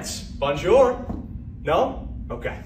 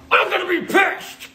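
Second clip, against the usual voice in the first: angry threat; 10 semitones higher than usual. Acted. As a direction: threaten the careless son. Dad scolds son over bag.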